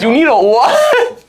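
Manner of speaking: a stunned teacher who can't stop laughing; laughing disbelief. Retold or imitated. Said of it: "starts dying of laughter".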